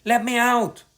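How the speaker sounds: high, loud panicked cry; frightened wail. A narrator's reading. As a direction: wail to escape. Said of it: "wailed Nick".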